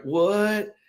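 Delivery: put-on high, disbelieving yelp of a kid; surprised kid squeaking; astonished what. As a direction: react like you can't believe your luck. Child stunned by offer.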